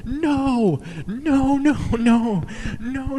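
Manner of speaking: high frantic squeal of no's; a mock-panicked high wail. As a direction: beg them to stop in a shrill panic. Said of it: "both of us were like".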